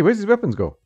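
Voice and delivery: usual speaking voice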